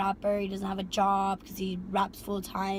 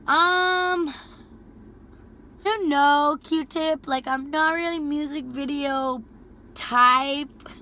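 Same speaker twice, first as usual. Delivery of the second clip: hesitant dodge; 4 semitones higher, 6 dB louder, much slower than usual. Retold or imitated. Declining music video offer.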